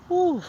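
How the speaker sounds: high, loud ooh of sore calves; pained ooh. Real, not acted. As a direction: yelp at the ache in the legs. Calves hurting mid-walk.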